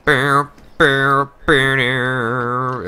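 mock cinematic bass hits; voiced dramatic trailer booms; trailer boom sounds. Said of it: "it started with a trailer".

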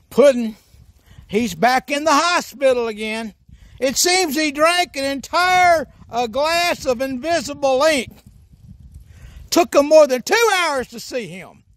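usual speaking voice

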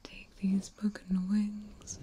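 ordinary talk, nothing marked

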